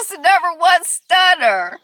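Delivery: loud, high, drawn-out hype; rousing call and response. Real, not acted. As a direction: call it out like a show host. Hyping up the audience.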